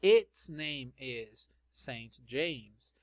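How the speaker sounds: slow, careful reading of "its name"; slow and deliberate teacher model; slow model sentence. Shown as a demonstration. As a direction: dictate it slowly to the class. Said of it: "model sentence".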